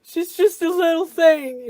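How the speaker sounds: mother breaking down over her daughter, crying out high; starting to cry. Acted. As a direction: let it crack as the tears come. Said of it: "And she starts to cry".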